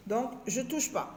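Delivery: ordinary talk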